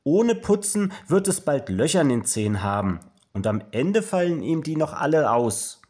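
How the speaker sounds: high, loud, dismayed; horrified warning. A narrator's reading. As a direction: warn like an aghast mother. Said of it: "fragt Mama entsetzt".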